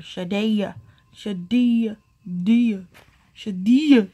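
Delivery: Shadia with the D hit hard, SHA-DEE-uh. Retold or imitated. Imitating people who hit the D in Shadia hard. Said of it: "some people like to say that D hard".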